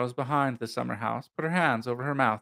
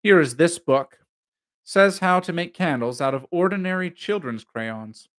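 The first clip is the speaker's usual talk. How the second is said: higher, effortful show of interest; valiantly entertaining; 5 semitones higher, 6 dB louder than usual. A narrator's reading. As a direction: present a dull find as if fascinating. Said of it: "endeavoring valiantly to entertain".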